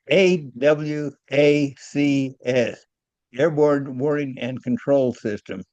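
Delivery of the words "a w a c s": slow letter-by-letter spelling. Shown as a demonstration. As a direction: spell it out at half speed, letter by letter. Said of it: "Can you spell it out".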